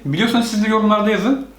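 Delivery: ordinary talk, nothing marked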